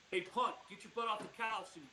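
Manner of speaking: a cocky dog bossing the owner; bossy dog voice. Pretend. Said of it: "what voice is gonna come out of him".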